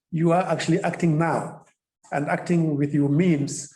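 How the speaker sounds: normal talking voice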